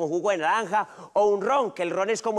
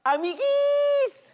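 high squeaky cutesy voice; squeaky cutesy greeting; much slower than usual. Acted. Playful variant greeting.

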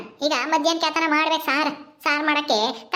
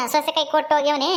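ordinary talk, nothing marked

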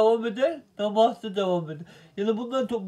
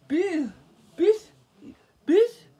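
rising shout; 8 semitones higher, 4 dB louder, much slower than usual. Acted. Preacher building to a cry.

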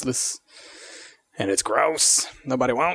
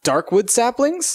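surprised; 12 semitones higher, 4 dB louder, slower than usual. Real, not acted. Puzzled at strange saplings.

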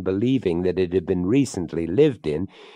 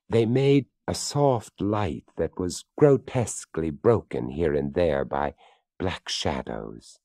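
usual speaking voice

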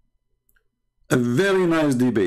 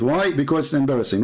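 usual speaking voice

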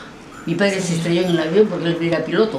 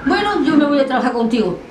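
higher-pitched, firm retold challenge; defiant acceptance; 8 semitones higher than usual. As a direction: stand up to the man, pitched up. Retold face-off, standing up to him. (aceptación desafiante, en tono más agudo)

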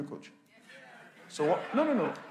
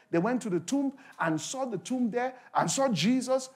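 louder, higher, fervent preaching; preaching at full force; 7 semitones higher, 8 dB louder than usual. Acted. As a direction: lift the congregation with rising fervour.

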